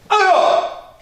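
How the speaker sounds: sharp high mock attack shout; a loud staged karate yell; attacker yell. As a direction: bark it like a lunging attacker. Attacker lunges in demo.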